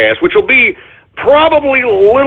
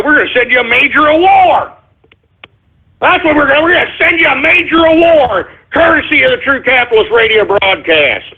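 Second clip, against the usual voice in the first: excited hype; 8 semitones higher than usual. Real, not acted. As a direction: sell the prize big. Hyping the prize.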